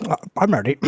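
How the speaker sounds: talking with a mouth full of food. Pretend. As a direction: push the words past a mouthful. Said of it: "with a full mouth be like".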